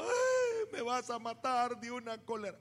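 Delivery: high wailing imitation of a crying mom; hammed-up crying mom; mock crying mother. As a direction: whine it like a mother in tears. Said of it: "ella terminó llorando".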